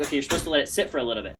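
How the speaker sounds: rattled off fast, lightly voiced wife; fast, offhand reminder from his wife; quick wife instruction. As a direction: rush it like a patient reminder.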